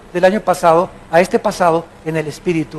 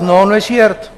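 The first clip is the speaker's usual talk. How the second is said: ordinary delivery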